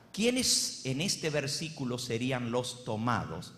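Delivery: ordinary talk, nothing marked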